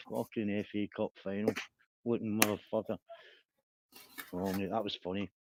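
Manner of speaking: normal talking voice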